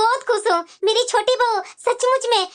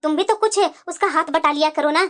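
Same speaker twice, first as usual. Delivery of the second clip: lower mother-in-law voice; 7 semitones lower than usual. Acted. Mother-in-law scolding elder bahu.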